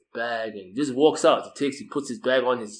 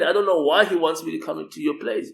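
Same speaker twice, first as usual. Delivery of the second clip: ordinary talk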